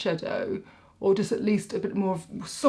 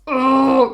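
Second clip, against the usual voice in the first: loud, higher-pitched groan of loathing; disgusted groan; 6 semitones higher, 13 dB louder than usual. Real, not acted. Vlogger groaning about doing her accounts, loud disgust. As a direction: groan "ugh" loudly at a hated chore.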